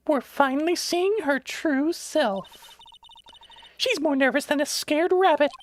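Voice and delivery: squeaky high voice for another character. Acted. Voicing game character, high far.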